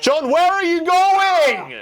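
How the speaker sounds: high, loud, drawn-out call at the TV; yelling at screen. Real, not acted. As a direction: shout at the character, slow and stretched.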